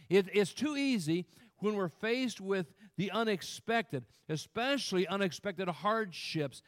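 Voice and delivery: loud, high-pitched pulpit urgency; forceful preaching. Real, not acted. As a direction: press the warning on the congregation.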